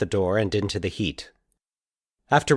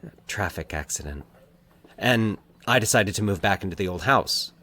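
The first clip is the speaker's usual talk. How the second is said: halting. A narrator's reading. Mike haltingly telling Valerie his parents died. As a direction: stop and start as if it hurts to say. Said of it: "he explained haltingly".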